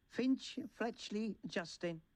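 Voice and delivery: high-pitched calling out of names; formal name call, pitched well up; roll call. A narrator's reading. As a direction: call each name high across a hall.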